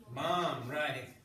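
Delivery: ordinary talk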